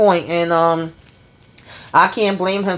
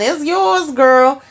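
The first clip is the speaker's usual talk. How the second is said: sassy high reply; 9 semitones higher, 4 dB louder, much slower than usual. Retold or imitated. Voicing Danny's reply.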